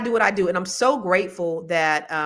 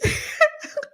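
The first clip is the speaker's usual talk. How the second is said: high burst of laughter. Real, not acted. Breaks into laughter mid-story.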